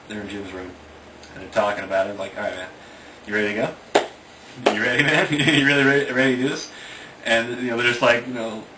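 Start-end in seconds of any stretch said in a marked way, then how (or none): 2.4-6.7 rising, louder, eager egging on; hyped-up buddy. Retold or imitated. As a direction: pump your friends up, getting louder. Teen pumping friends up for adventure.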